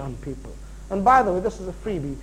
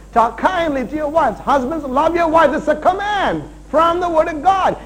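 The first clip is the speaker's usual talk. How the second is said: raised, higher, insistent sermon voice; full-force preaching; 8 semitones higher, 6 dB louder than usual. Real, not acted. Preacher commanding the husbands in church, full-force preaching. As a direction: press it as a command.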